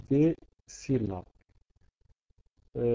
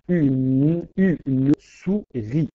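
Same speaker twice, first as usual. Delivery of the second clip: slow, louder, "Une" then "souris" each syllable marked; counting syllables slowly; 5 dB louder, much slower than usual. Shown as a demonstration. Teacher to class, slowly counting out "une souris" again. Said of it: "nous avons une pause".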